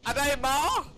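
high loud shocked ask; disbelieving cry; shocked question. Real, not acted. Shocked at teammate's death.

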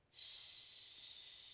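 put-on whispered shush to a sleeping baby; cooing shush. A narrator's reading. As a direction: hush the baby, breath only. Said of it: "the woman cooed at her baby".

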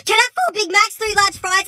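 ordinary talk, nothing marked

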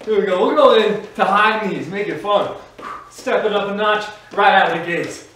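loud upbeat workout coaching; aerobics instructor. Acted. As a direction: pump up the class, energetic. Fitness instructor pumping up class.

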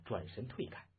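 ordinary delivery

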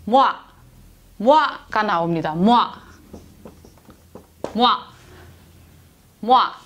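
모아 run together as "mwa"; pronunciation of 모아. Shown as a demonstration. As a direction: model 모아 as one syllable, m gliding into wa. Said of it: "무슨 소리가 나올까요". (모아를 '뫄' 한 음절로 발음)

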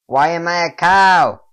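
high, loud cry of disbelief; dismayed shout. Pretend. Player discovers he has become a cow, dismayed shout. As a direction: shout it out in mock outrage.

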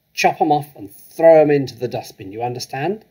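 loud, harsh bark; barked order. Acted. Headmistress ordering the pigtails thrown away, loud bark. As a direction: snap the command like a drill sergeant. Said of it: "she barked".

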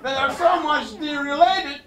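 loud, high shouted disbelief with laughs; mock outrage at the TV. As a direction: rant at the television in fake fury. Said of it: "I really shouted at my television".

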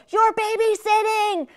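high, loud, drawn-out call over the shoulder; retold yell. Mom yelling to her twelve-year-old on the way out, loud. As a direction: shout it while running out the door. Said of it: "screaming to my twelve-year-old".

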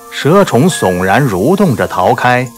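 usual speaking voice

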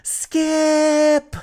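high, loud, drawn-out cry; desperate shouting. Acted. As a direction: yell it into the empty sky. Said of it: "he cried into the sky".

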